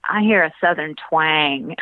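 put-on stretched Southern accent on twang. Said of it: "that word had way too many syllables in it".

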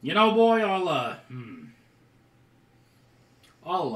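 loud, high dwarf smith voice; dwarf shopkeeper. Acted. Dwarf smith weighing up a trade, loud dwarf shopkeeper voice. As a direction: boom it out high as a dwarf, then hesitate.

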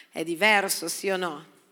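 ordinary talk

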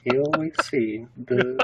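nature documentary. Acted. Documentary narrator voice.

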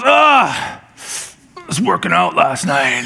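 mock groan of sore muscles; pained groan. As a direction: grumble through the ache after working out. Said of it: "He goes".

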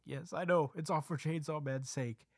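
high-pitched mock nervous agreement; afraid. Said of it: "Izumi is just afraid".

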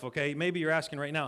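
usual speaking voice

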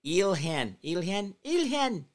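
"Ilhan" tried out, climbing high; practicing Ilhan. Shown as a demonstration. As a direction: sound out "Ilhan" with the L, rising. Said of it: "I gotta get this right".

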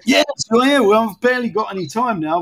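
suddenly loud, high greeting. Real, not acted. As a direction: call out over a bad line. Greeting co-host joining call.

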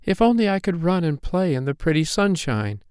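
high, restrained wailing; plaintive wail. A narrator's reading. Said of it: "she wailed".

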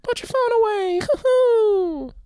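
a tiny dough mascot telling students off; doughboy teacher with hoo-hoo giggles; Pillsbury Doughboy. Retold or imitated. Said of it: "Could you imagine him too?".

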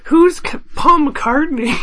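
high, mock clueless kid. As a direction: play a clueless young fan. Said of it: "one of those kids who's, like, tweeting".

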